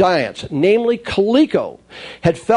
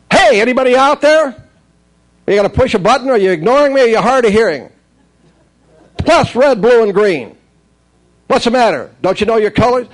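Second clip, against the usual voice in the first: higher, louder, taunting toy voice; snotty talking toy; 4 semitones higher, 4 dB louder than usual. Retold or imitated. Talking toy mocking its player, in a higher, louder, snotty voice. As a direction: needle the player like a bratty electronic game. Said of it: "the snottiest game you have ever heard".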